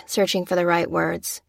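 ordinary delivery, nothing marked

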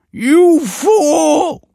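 very high, loud scream of fury; piercing scholarly cry; outraged cry. A narrator's reading. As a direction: shriek it in horrified outrage. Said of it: "cried the scholar".

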